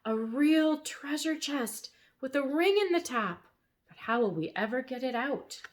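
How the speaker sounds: a touch of awe over the buried chest; excited gasp. A narrator's reading. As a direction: marvel at the find.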